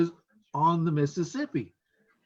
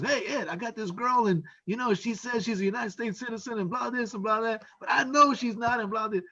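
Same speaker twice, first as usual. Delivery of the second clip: higher-pitched voice for a retold caller; agitated caller imitation; 6 semitones higher than usual. Man phones officer about a girl he doubts, higher imitated voice. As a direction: play the insistent guy on the phone, pitched up. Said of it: "I get this phone call from this guy".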